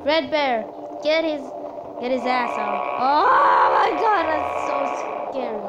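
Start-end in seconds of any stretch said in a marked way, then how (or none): 3.0-4.2 scared cry. Real, not acted. Scary scene, crying shout.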